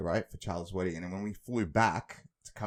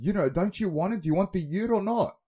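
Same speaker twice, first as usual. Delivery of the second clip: loud; 8 semitones higher, 4 dB louder than usual. Retold or imitated. Sleeping colleague pushing ute.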